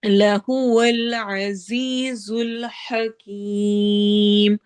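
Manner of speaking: slow chanted tajweed with a long drawn ha; Quranic recitation. Shown as a demonstration. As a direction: recite it, stretching the vowel. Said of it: "سیٹی والی آواز ہوگی".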